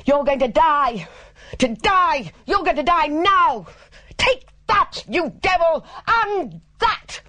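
high-pitched hysterical shouting; very high, frenzied cry in bursts; shouted death threat. A narrator's reading. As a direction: shriek it in a murderous frenzy. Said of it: "then he shouted out".

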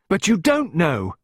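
high, slow, loud protest; desperate. A narrator's reading. Pippa correcting Clarissa's soothing, desperate. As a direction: insist desperately on being understood. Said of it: "sounded even more desperate".

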